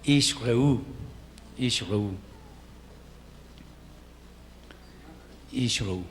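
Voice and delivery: Ishreu said slowly, first sheva silent, ish-REU; Hebrew pronunciation demo. Shown as a demonstration. Teacher modelling Ishreu for students, careful pronunciation. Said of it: "esta es la pronunciación".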